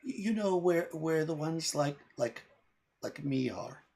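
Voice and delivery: put-on high, halting little girl voice, afraid of being eaten; small scared child asking a nervous question; timid fey girl. Fey girl asking the humans if they will eat her, high and timid. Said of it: "and she says".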